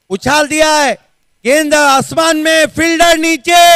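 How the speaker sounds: high, loud, urgent call; rising tension as the ball goes up; excited cricket commentary. Real, not acted. Calls a skied catch.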